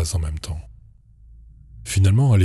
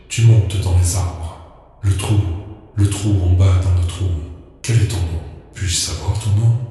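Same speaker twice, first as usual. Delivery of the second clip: creature babble. Acted.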